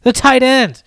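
high, scoffing mock outrage. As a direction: scoff at the idea, voice up. Mock scoffing at tight end pick.

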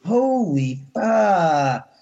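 slow, stretched shocked curse; stunned. Retold or imitated. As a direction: drag it out in disbelief. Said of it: "I'm like".

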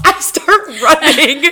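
words through a high laugh. Real, not acted. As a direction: laugh while you say it, slowly. Laughing while retelling.

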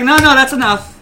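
loud, high mock protest; mimicked refusal. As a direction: imitate her cutting off the pour. Mimics a woman refusing more beer.

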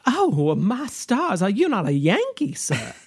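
put-on slow, swooping Southern belle accent; drawn-out Southern drawl, falling away at the end; Scarlett O'Hara, Southern. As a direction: play an astonished Southern lady. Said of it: "borders on Scarlett O'Hara, uh, of Southernness".

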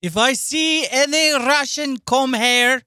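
menacing mock Russian threat; thick Russian accent, menacing and loud; heavy Russian accent menace.

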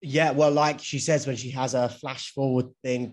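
usual speaking voice

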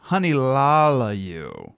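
slow, drawn-out tipsy delivery; drunken slur. Shown as a demonstration. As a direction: stretch it out like a happy drunk. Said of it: "like when you drank too much".

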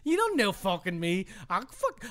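mock-belligerent muddled Irish; garbled Irish accent. Telling co-host to fuck off, hammed-up Irish accent. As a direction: pick a fight in a badly faked Irish accent.

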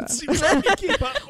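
laughing echo. Real, not acted.

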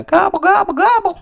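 high, loud turkey gobble; gobbling turkey voice. Pretend. Turkey noise after Thanksgiving wish.

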